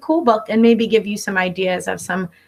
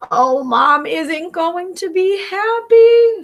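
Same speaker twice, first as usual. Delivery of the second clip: higher, slow drawn-out uh-oh voice; mock dismay; 8 semitones higher, 4 dB louder, much slower than usual. Pete looking at the lava mess, slow higher mock dismay. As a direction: stretch it out like a kid in trouble.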